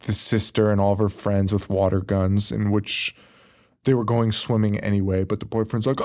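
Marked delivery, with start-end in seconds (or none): none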